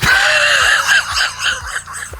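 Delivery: very high, squealing laugh. Real, not acted. Presenter cracks up after his Scottish attempt, high laughter. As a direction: crack up at your own silly accent.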